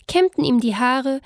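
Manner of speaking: ordinary delivery, nothing marked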